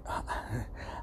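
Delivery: quiet breathy laugh through tears; a breath of a laugh, barely voiced; soft breathy laugh. Real, not acted. Laughing softly while talking about his dead cat.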